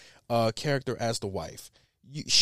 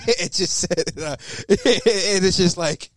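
usual speaking voice

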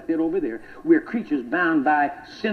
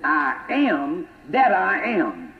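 I am that I am drawn out slowly, each word given weight; slow reverent emphasis on I am that I am; slow, weighty; much slower than usual. Shown as a demonstration. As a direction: savour every word of it. What kind of talk is that?.

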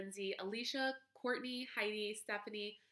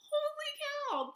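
much higher, real burst of surprise; surprised exclaim. Real, not acted. Host hearing the group hit a hundred members, surprised. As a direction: blurt it out, genuinely floored.

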